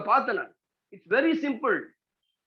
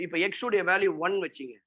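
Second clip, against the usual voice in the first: normal talking voice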